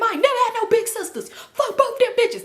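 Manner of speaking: high, indignant mock rant; ranting inner thought. Fuming privately about both sisters, high mock-indignant rant. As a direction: whine the outrage way up high. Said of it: "That's what I'm in my head".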